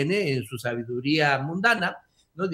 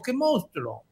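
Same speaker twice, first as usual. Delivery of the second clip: mock exclamation; 7 semitones higher, slower than usual.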